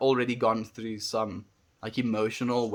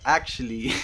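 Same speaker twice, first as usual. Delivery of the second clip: laughing realization. Retold or imitated. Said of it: "you kind of start thinking, like".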